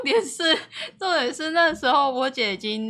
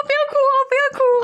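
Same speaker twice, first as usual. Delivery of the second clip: much higher, louder, fast panicky plea; mock sister hushing; 13 semitones higher, 5 dB louder, faster than usual. Big sister pressing pillow on crying sibling, hammed-up urgent plea. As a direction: frantically urge the kid to stop crying. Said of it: "她说".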